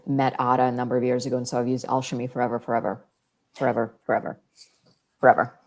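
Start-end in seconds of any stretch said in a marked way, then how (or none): none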